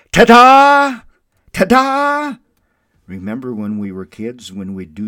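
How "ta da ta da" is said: high, loud triumphant yell. Retold or imitated. Little girl yelling to the class, arms up, loud and high. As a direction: throw it out like a magic reveal. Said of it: "and yelled".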